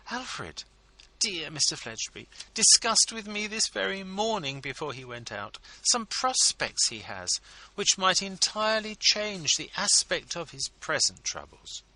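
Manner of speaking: high, confiding, intriguing tone; wife confiding her husband's hopes, intriguingly; very interesting manner. A narrator's reading. Mrs Lammle voiced by narrator.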